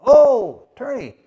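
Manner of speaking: high, loud "oh" of sudden realization; a startled, hammed-up oh; mock surprise. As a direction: act like it just dawned on him. King reacts on hearing about the attorney.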